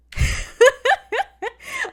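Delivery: a loud, high laugh bursting out; sudden high-pitched laugh; high burst of laughter. Real, not acted. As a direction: crack up loudly.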